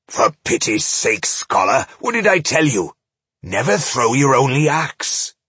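put-on rasping, scolding old dwarf; gruff old dwarf. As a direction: scold the scholar, half-whispered then loud.

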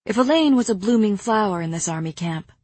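plain reading voice

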